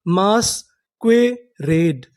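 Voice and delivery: very slow, drawn-out reading; मास्क्वेरेड drawn out slowly, syllable by syllable; slow syllable demo. Shown as a demonstration. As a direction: stretch each syllable of मास्क्वेरेड. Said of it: "तो हमारी पूरी meaning क्या बनी?".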